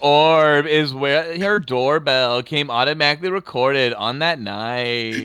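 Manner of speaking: stretched, oddly stressed narrator cadence; video narrator impression. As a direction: draw out every word, emphasis on wrong syllables. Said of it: "You do a pretty good one".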